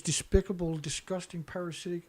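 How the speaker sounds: words falling away, too choked to finish; choked up, fading out. Real, not acted. Ranting about the UN to viewers, trailing off overcome with emotion. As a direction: voice dying away into near silence as emotion takes over. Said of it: "little emotional sometimes".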